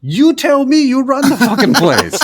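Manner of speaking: high, loud retort; yelling back. Retold or imitated. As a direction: shout it back at him. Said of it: "I started yelling back at him".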